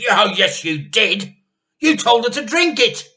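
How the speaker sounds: loud, rising high on the last line; loud accusation. Acted. Wife accusing her husband of the medicine, loud and high. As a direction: jab the blame at him.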